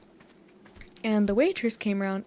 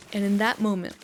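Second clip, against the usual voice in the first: ordinary delivery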